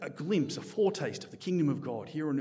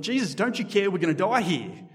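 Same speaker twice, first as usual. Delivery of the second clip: louder, higher retold shout in a storm; mock panicked shout; 5 semitones higher, 7 dB louder than usual. Disciples waking Jesus in the storm, a mock panicked shout. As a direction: call out to him as if the boat is sinking. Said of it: "they shout at him".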